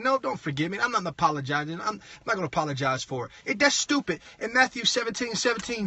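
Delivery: usual speaking voice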